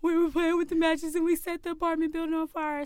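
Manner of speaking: high, loud mock-wailing confession; imitated crying. Child confesses the fire to dad, loud imitated crying. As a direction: blurt it all out in a hammed-up cry. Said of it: "by this time I'm crying".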